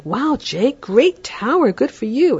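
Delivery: high, eager praise; enthusiastic praise. Shown as a demonstration. Parent praising Jake's Lego tower, high eager gushing. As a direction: gush over the tower.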